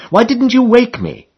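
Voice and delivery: a hammed-up huffy retort; mock snarl. Quarrelling friend demanding why he was not woken, mock indignant. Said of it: "We snarled at one another".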